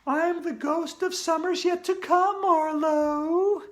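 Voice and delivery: high, eerie ghost voice; spooky voice. Acted. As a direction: announce yourself spookily. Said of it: "said in a spooky voice".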